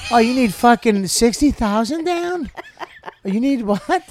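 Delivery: high-pitched, loud disbelief; mock incredulous buyer. House hunter hearing the down payment, shrill incredulity. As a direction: act floored by the price, voice jumping up.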